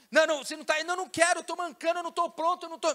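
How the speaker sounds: high, loud protesting refusal; whiny no, pleading not to go; reluctant guest. Acted.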